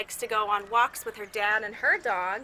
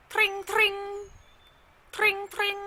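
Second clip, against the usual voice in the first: high, bright ringing tring; a bicycle bell ringing twice; 11 semitones higher, slower than usual. Shown as a demonstration. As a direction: ring it high like a bike bell. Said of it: "A bicycle bell ringing".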